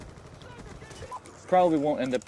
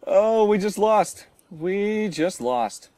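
very slow, drawn-out disbelief; stunned drawl; 8 semitones higher, 6 dB louder, much slower than usual. Real, not acted. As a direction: stretch every word, stunned. Team just lost.

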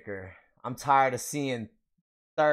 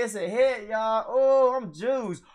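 ordinary delivery

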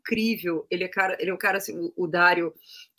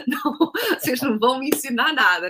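laughing; 5 semitones higher than usual. Real, not acted. She laughs at the crew.

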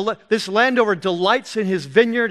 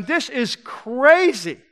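drawn-out, higher-pitched disbelief; incredulous reaction; 5 semitones higher, 3 dB louder, much slower than usual. Acted. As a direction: stretch it out as an astonished listener. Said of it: "if I'm there, I'm going".

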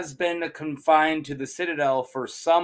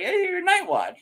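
much higher pitch, amazed; mock surprise. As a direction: exclaim it as a discovery. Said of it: "He's like".

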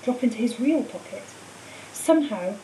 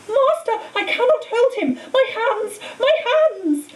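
pained cry; 11 semitones higher, 3 dB louder than usual. A narrator's reading. Quirrell in burning pain.

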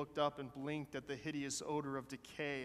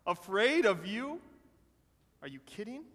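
amused scoff; 5 semitones higher, 6 dB louder than usual. A narrator's reading. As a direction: scoff as if just done laughing. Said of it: "and chuckled, laughed".

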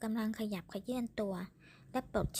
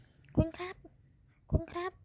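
calling out; 8 semitones higher, slower than usual. A narrator's reading. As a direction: call out to find her. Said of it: "กล้องตะโกนเรียกผู้หญิงคนนั้น".

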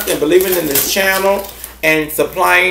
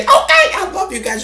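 comic, very high-pitched okay; squeaky catchphrase echo. Pretend. Host echoing the okay to subscribers, squeaky. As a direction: squeal the okay back playfully.

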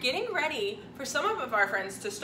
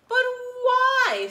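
put-on high, loud whiny little girl; cartoon princess; 11 semitones higher, 8 dB louder than usual. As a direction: squeak it out like a spoiled princess. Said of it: "said the little princess".

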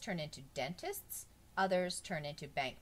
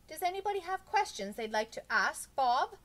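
lightly voiced teacher, higher and louder; pitched up, projecting to a whole class; teacher asking; 11 semitones higher, 7 dB louder than usual. A narrator's reading. Teacher inviting the class to question Bob, projecting.

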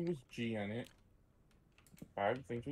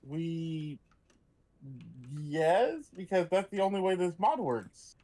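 loud insisting; 5 semitones higher, 6 dB louder than usual. Real, not acted. Insisting, arguing version.

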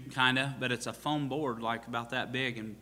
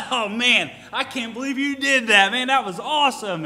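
much higher, louder thrilled gushing; excited; 11 semitones higher, 8 dB louder than usual. Retold or imitated. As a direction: rave like an overjoyed partner. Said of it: "And he's all excited".

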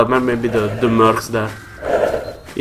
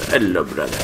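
lower, softer "Hello, brother"; low mock greeting; 8 semitones lower, 8 dB quieter than usual. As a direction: drop into a deep hushed "Hello, brother".

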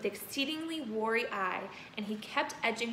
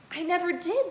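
exclaiming; 8 semitones higher, 5 dB louder than usual. Acted. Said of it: "the boy exclaimed".

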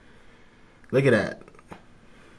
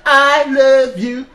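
high, slow cooing declaration; louder slow baby-talk love; mock tender; 9 semitones higher, 4 dB louder, slower than usual. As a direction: tell the crab you love it, drawn out and sweet. Cooing at the live crab.

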